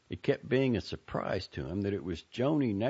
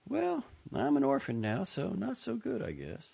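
dry; 13 semitones higher than usual. A narrator's reading. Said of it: "she said dryly".

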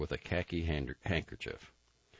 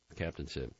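ordinary delivery

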